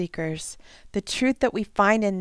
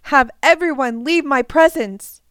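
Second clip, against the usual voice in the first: loud commanding cry, pitched up; 5 semitones higher, 7 dB louder than usual. Acted. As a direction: order the room cleared at full voice. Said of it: "He cried out".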